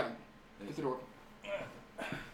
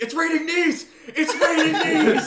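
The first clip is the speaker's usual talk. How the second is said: high, loud laughing yell, second slower; mock alarm; 11 dB louder, slower than usual. Friend hamming up the show chaos, loud laughing yell. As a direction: shout it like chaos breaking out. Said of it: "He's like".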